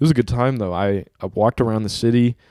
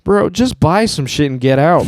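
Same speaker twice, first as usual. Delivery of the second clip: mock irritated, louder and higher; fed-up clerk; 5 semitones higher, 6 dB louder than usual. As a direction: imitate an impatient store clerk. Acting out fed-up store clerk.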